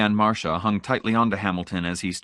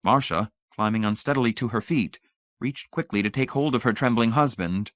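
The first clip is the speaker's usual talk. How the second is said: ordinary delivery, nothing marked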